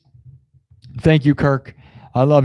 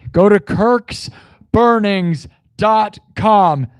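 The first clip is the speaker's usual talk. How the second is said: slow ad plug; 6 semitones higher, 3 dB louder, much slower than usual. Acted. Plugging a viewer's sign shop.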